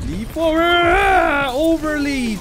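high yell stretching into a scream; battle cry. Real, not acted. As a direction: cry out wildly mid-leap. Yells while leaping in game.

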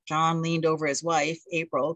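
usual speaking voice

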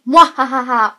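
slow, loud evil cackle; mustache twirling villain. Pretend. Halloween sign-off, villain cackle.

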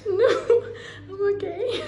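insisting she's fine through laughter; laughing. Real, not acted. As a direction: giggle it out. Laughing while denying crying.

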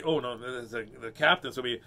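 usual speaking voice

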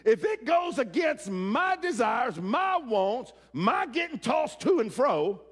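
full-force preaching. Acted. Preacher pressing the verse on his congregation, full force. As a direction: preach it hard to the congregation, drawn out.

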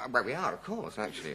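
ordinary talk, nothing marked

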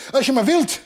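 high, excited puffing bird; panting. A narrator's reading. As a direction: puff it out loudly and high. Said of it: "hijgde het".

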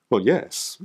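usual speaking voice

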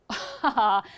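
short acted burst of laughter; bear's laugh. Bear hearing the angel's silly name, acted laughing. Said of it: "The bear started laughing".

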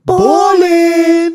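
imitating a hyped-up ad-lib shout; imitated yell. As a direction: yell it out like the hype friend. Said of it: "And he would yell".

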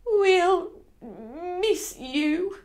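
high, very drawn-out wobbling voice; tearful quaver. Acted. Housekeeper saying goodbye to Enola, high tearful quaver. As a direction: stretch each word, quavering near tears. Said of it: "quavered Missus Lane for a moment".

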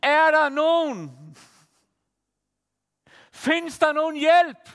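retold shout. Desperate woman calling out on a field, retold shout. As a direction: call out slowly into the empty field, half re-enacted. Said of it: "råber bare".

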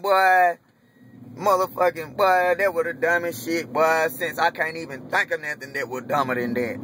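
normal talking voice

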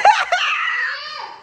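squealing real laughter; high shrieking laugh. Real, not acted. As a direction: burst into a high, shrieking cackle. Laughs at her own gloating quote.

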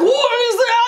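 high, astonished gasp of a question; high-pitched amazed squeal; surprised squeal. Retold or imitated. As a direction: squeal it like you've spotted a treasure. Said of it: "And I was like".

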